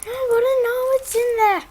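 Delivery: high, loud whining plea; high pitched pleading whine. Real, not acted. Whining to see the item.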